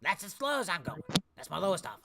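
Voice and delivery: put-on old man slamming down his lowest price; haggling old man. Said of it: "We're not bargaining, old man".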